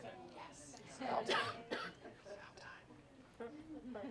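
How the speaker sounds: whispered grumble, voiceless and slow; muttered aside. Acted. C type whispering about the late colleague, slow and voiceless. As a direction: hiss it under your breath at the latecomer.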